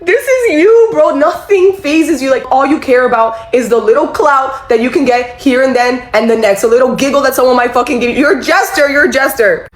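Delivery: high heated rant that cools toward the end; retold ranting yell. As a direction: tear into the boss, winding down. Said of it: "I'm literally going crazy".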